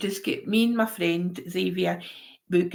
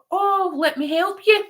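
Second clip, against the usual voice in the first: helpful offer; 10 semitones higher than usual. Retold or imitated. Helping a stranger with her stuck suitcase, high helpful offer. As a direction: pitch it up eagerly to offer help.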